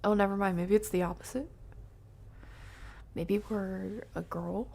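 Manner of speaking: ordinary delivery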